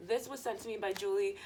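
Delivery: usual speaking voice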